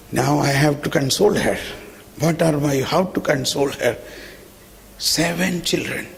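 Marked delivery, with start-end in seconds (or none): none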